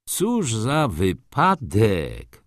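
slow, drawn-out cry of surprise; a lightly voiced old gentleman calling out; exclaiming. A narrator's reading. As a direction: exclaim it in mock amazement. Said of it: "zawołał do Wokulskiego".

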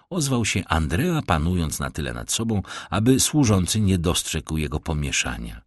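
normal talking voice

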